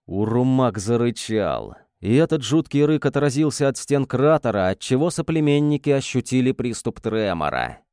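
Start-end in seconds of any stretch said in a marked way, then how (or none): none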